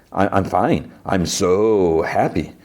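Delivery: mock-happy with a long drawn-out "so"; retold sarcasm, stretch it out slowly; insincerely claiming to be fine; sarcastic. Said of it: "obviously, sarcasm".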